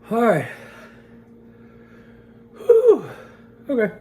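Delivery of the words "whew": loud high whew from the spice. Real, not acted. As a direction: blow out hard against the burn. Burning mouth after hot pepper.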